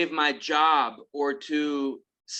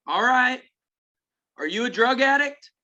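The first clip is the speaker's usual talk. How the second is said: higher-pitched imitation of a country sponsor; country accent; 9 semitones higher, 4 dB louder than usual. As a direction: question him in a put-on country accent, pitched up. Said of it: "real strong country accent like this".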